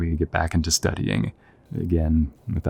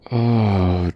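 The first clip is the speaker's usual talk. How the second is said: ordinary talk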